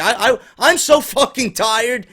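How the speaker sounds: ordinary delivery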